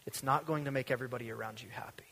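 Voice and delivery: quick hushed whisper; breathless whispered aside. Real, not acted. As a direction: drop to a fast whisper as a warning. Warning aside mid-sermon.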